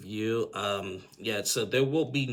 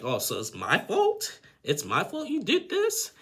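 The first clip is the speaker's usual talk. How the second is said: incredulous outrage; 11 semitones higher, 6 dB louder than usual. Retold or imitated. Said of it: "And she's like".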